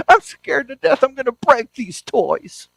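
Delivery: put-on high, loud, fearful child's whimper; scared little boy. Pessimist boy voiced, crying.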